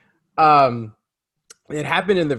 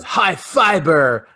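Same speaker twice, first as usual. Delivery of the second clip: slow, louder mock fight taunt; Batman impression; 3 semitones higher, 6 dB louder, much slower than usual. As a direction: imitate a hero's corny one-liner, drawn out. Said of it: "And he goes".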